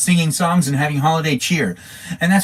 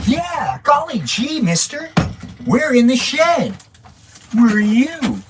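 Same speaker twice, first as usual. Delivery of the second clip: high cartoon voice; 10 semitones higher, slower than usual. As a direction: squeak it out like an old-timey kid. Silly old-timey voice after Partridge family.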